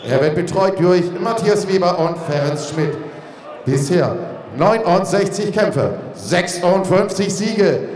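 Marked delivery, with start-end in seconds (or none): none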